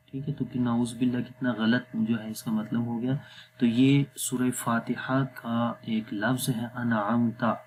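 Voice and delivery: usual speaking voice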